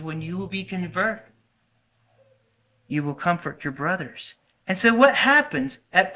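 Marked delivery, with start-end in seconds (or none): 0.0-4.3 much softer, gentle quoting; tender words of Jesus. Retold or imitated. Preacher quoting Jesus to Peter, soft and tender. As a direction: speak it softly, like a promise.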